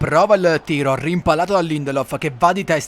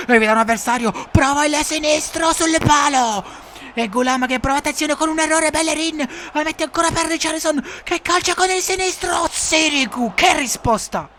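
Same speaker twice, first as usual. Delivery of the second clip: high excited build to the save; excited commentary; 12 semitones higher than usual. Acted. Chance and save.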